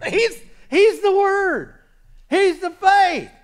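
high, loud, slow drawn-out proclamation; full-force preaching. Real, not acted. Preacher answering whether the Lord feared, full-force preaching. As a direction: proclaim it slowly to the whole church.

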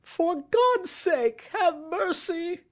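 high, slow, desperate shriek; shrieked plea. A narrator's reading. Culprit throws himself down begging, shrieking. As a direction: beg wildly for mercy, pitch right up. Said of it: "he shrieked".

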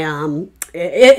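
normal talking voice

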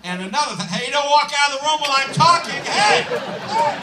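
high, loud hey building; indignant yelling at cat, climbing; mock angry yelling. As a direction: shout after the cat.

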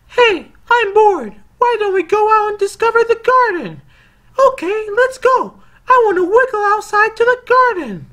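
put-on very high, loud squeaky baby snake voice; tiny baby snake. Baby snake to its sibling, very high squeaky excited voice. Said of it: "baby snake is talking to the other baby snake".

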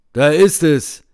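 a man announcing the grave; loud call. A narrator's reading. A third voice calls out.

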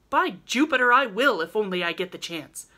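loud, sudden burst of energy; sit up and cry it out; eager cry. Acted. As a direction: Laurie fired up with sudden energy, crying out. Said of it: "cried Laurie".

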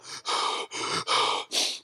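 long breathy sigh letting go; deep release sigh. Retold or imitated. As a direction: exhale slowly as if released. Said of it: "I was like".

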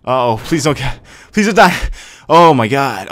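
high, loud plea; panicked yelp, then relief; real panic. Real, not acted. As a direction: beg in sudden alarm. Fears the Pokemon will faint.

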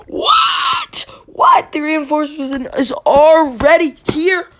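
high, loud squealed astonishment; incredulous outburst, voice leaping up; shocked disbelief. Acted. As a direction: shriek in hammed-up shock. Acts out shock at reinforcements arriving.

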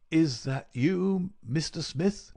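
high, slow, labored invalid's voice; feeble sick voice. A narrator's reading. Sick man greets his visitor, faint and effortful. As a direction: drag the words out weakly. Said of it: "Holmes whispered".

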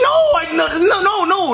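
high-pitched, flustered protest; stammered, panicky objection; alarmed denial. Acted. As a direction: rush to deny it in a panic. Denying a shocking accusation.

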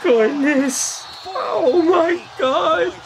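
high, drawn-out, overcome wail; getting emotional. Real, not acted. Fan getting a tear over his hero's game, high emotional wailing. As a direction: choke up with joy, half laughing, half crying. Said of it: "legit getting emotional".

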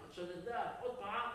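usual speaking voice

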